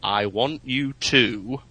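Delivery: imitating very slow, word-by-word speech; mock-patient, spaced-out words; slow, simplified speech. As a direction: draw out each word for a slow listener. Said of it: "after a while, he's, he, he almost was saying".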